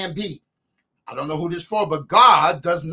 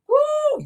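high whoop. Real, not acted. Preacher's exclamation.

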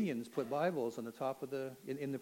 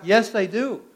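full, high, loud shout of affirmation; yelled back; 8 semitones higher, 17 dB louder, slower than usual. Retold or imitated. Inmate answering the Satanist's challenge, defiant loud yell. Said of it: "I yelled back".

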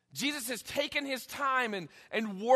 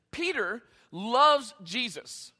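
ordinary talk

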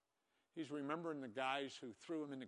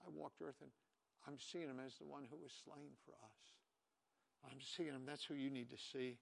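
soft reflective preaching; 6 dB quieter than usual. Acted. Pastor confessing to the congregation that they neglect God, hushed. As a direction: draw them in close with a hush.

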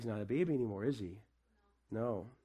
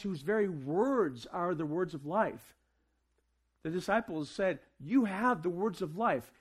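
raised, ringing sermon voice; preaching; 4 semitones higher than usual. Acted. Pastor preaching to the church at Christmas, ringing and raised. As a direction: preach it out to the congregation.

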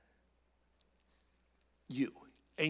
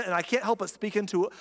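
ordinary delivery

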